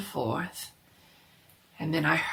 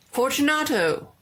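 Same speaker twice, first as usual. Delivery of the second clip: higher, louder call; calling aloud; 8 semitones higher, 6 dB louder than usual. A narrator's reading. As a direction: call into the dark niche. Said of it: "I called aloud".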